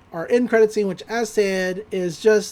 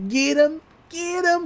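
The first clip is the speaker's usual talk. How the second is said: high-pitched little chant; mock cry in a raised pitch; imitated catchphrase; 8 semitones higher than usual. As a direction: put on a high voice for a repeated catchphrase. Acts out a character's catchphrase.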